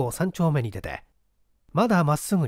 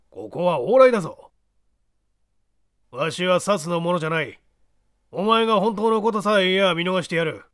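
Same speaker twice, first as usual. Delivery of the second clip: put-on stern hushed old man; creaky wise old man; 5 semitones higher, 3 dB louder than usual. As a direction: scold him, then threaten. Small man hushes, threatens.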